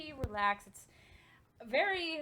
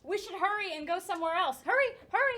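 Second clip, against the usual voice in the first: urgent hurry; 7 semitones higher, 6 dB louder, much faster than usual. Acted. Player voices character urging escape.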